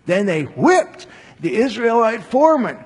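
normal talking voice

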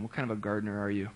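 quick, amused jab at the gardener; amused teasing. Real, not acted. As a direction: tease with a laugh rising in the voice. Joking about the gardener.

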